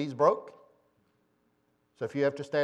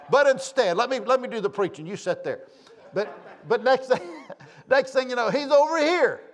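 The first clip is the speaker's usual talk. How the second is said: laughing while preaching; 10 semitones higher, 8 dB louder than usual. Real, not acted. Preacher laughing through his point.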